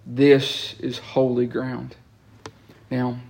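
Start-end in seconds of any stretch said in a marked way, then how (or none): 0.0-2.0 solemn declaration. Acted.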